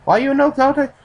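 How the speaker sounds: high, loud, badly faked Japanese accent; Japanese accent attempt. Narrator voices the Japanese man guessing at a power outage, Japanese accent. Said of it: "I can't do a Japanese accent".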